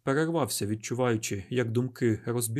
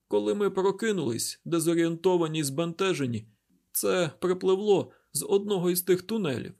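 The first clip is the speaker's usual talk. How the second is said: higher-pitched, slightly louder character voice; injured woman recounting events; 6 semitones higher, 4 dB louder than usual. A narrator's reading. Derkhan tells Isaac about waking up, higher-pitched recounting. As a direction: voice a woman urgently telling what happened.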